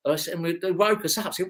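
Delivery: usual speaking voice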